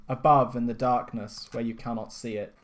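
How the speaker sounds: ordinary talk, nothing marked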